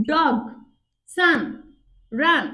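"ডগ, সান, রান" as isolated syllables, pauses between; one beat each. Shown as a demonstration. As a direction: give each word a single firm beat. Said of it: "একবার উচ্চারণ হয়েছে". ("ডগ, সান, রান" এক ঝোঁকে, আলাদা করে)

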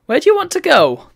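high, loud put-on voice; game character voice. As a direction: voice the game's guide character. Reads game character line aloud.

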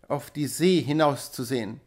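ordinary delivery, nothing marked

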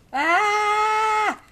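high drawn-out "ah"; startled cry. Real, not acted. Player crying out at a game mishap, startled. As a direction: cry out in dismay, drawing it out.

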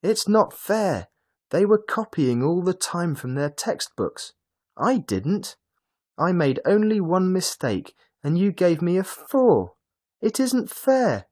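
high, loud, furious schoolboy protest; outraged child's complaint, high and loud; angry boy. A narrator's reading. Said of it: "the boy cried angrily".

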